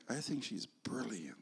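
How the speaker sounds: hushed private whisper; soft awed whisper. Retold or imitated. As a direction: breathe it like a secret admiration. Private thought to himself.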